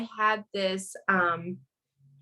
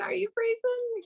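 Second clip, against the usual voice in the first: surprised high squeal; 4 dB quieter than usual. Real, not acted. Teasing host during connection glitch.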